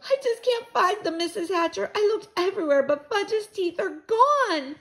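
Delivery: put-on high, tearful girl voice; sniffly. A narrator's reading. Girl confessing to boy's mother, tearful. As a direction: whimper it high, drawing out the end. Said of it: "Sheila sniffled and said".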